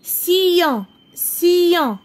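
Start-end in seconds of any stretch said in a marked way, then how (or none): none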